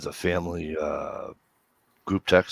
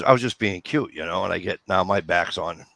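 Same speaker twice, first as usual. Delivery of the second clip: usual speaking voice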